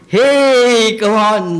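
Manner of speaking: loud, high whoop of put-on delight; hammed-up elated shout; mock elation. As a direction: cheer as if overjoyed, imitating someone carried away. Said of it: "or doesn't feel elated".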